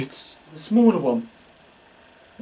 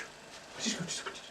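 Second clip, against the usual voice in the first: whisper; 22 dB quieter than usual. Acted. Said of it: "psychic asks his spirit guide".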